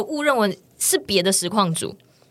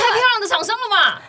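higher, shouted through laughter; laughing shout; 9 semitones higher than usual. Real, not acted. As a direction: crack up while shouting the line. Reacting to the story.

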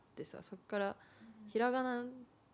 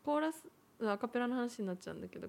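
normal talking voice

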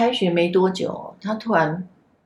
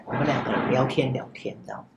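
ordinary talk

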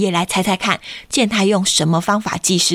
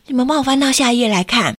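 plain reading voice

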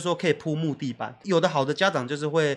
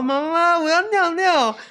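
put-on high, louder child's plea; whiny child; 12 semitones higher, 5 dB louder, slower than usual. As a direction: beg mom like a small kid. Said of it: "然后". (小孩撒娇，高声喊妈妈)

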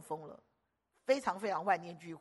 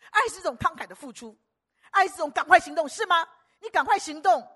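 higher, louder urgent sermon; fiery preaching; 7 semitones higher, 11 dB louder than usual. Acted. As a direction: urge them to act now. Preaching at full force. (激昂催促讲道)

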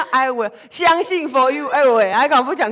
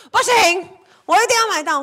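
higher, louder insistence; insistent; 8 semitones higher, 4 dB louder than usual. Retold or imitated. Sister insisting she must buy the eyeshadow, determined. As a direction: refuse to give up, pushing it out. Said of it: "她就说".

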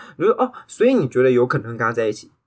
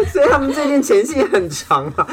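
laughing; 10 semitones higher than usual. Real, not acted. Laughing at overshare.

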